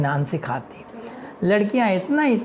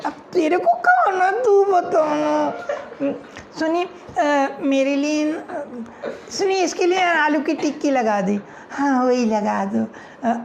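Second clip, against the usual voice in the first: put-on high giggly girlish voices; bickering schoolgirls; girls ordering; 8 semitones higher than usual. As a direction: dither over the order shyly.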